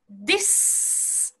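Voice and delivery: "This" as "dis" ending in a long hiss; breath sent through. Shown as a demonstration. Teacher introducing the syllable, "This" with a long airy s. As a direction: release the d and let s flow in "This". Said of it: "息を送るような感じ".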